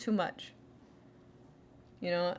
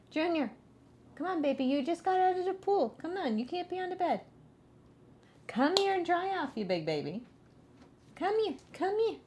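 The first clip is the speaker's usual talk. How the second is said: higher, coaxing voice to the dog; 8 semitones higher than usual. Real, not acted. Owner coaxes Junior off the bed, higher. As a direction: coax the wet dog sweetly.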